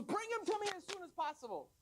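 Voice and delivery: high-pitched, urgent royal order; commanding king. Acted. King demanding the man be brought, urgent command.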